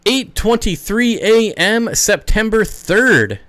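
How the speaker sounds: slow, high and loud, each piece stressed; pointed recital. Real, not acted. Telling Jess exactly when the email was sent, pointedly. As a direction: spell out the date and time emphatically.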